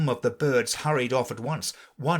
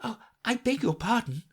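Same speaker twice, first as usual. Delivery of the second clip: put-on higher girlish voice, humble; humbly; 11 semitones higher than usual. A narrator's reading. Alice apologising to the mouse, humble. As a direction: apologise meekly. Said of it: "said Alice very humbly".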